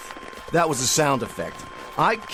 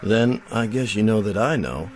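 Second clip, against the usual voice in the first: tough TV marshal; 9 semitones lower, 4 dB quieter than usual. Acted. Marshal to the saloon boys, low and cocky. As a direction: play the cool lawman facing down the saloon.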